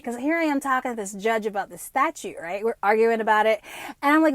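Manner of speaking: ordinary talk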